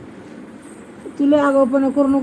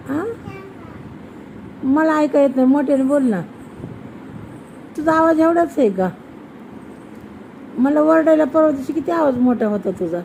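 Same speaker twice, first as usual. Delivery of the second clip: ordinary talk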